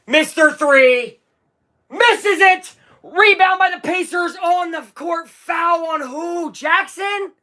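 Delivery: high, rising live call; excited play-by-play. Real, not acted. Streamer calling a tense late possession, excited. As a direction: call the rebound and foul as they happen.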